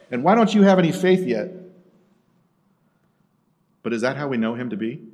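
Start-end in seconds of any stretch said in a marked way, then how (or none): 0.1-1.5 loud, raised-pitch scolding; exasperated, forceful telling-off; angry rebuke. Acted. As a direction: rebuke them angrily, voice up. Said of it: "angrily turns towards his disciples and rebukes them".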